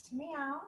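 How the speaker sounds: high, soft little kitten meow; cat meow. Shown as a demonstration. Yoga teacher to kids, high soft cat meow. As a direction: mew like a small cat, up high.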